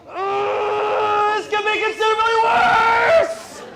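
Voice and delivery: high, loud, slow hammed-up yell; mock outraged shout. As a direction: shout it out like an overdramatic driver. Mock hippie driver outrage.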